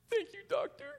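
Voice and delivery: put-on high, tearful little-boy voice; trying to be tough. Crying boy thanks doctor after shot, trying to be tough. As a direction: imitate a child holding back crying. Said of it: "trying to be tough".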